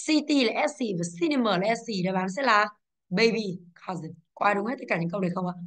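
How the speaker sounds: usual speaking voice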